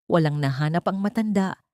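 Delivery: ordinary delivery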